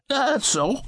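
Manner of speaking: high, mocking echo of the phrase That's so. A narrator's reading. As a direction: throw That's so back in a high mock voice. Fred mimics narrator's reply.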